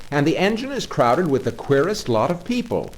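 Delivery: high, loud, breathless listing; excited driver. Acted. Engine driver describing the pursuers to Toad, excited and loud. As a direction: pile up the alarming sights one after another.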